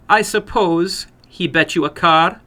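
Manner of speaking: probing, unsurprised; suspicious older woman. Acted. Woman voiced in story.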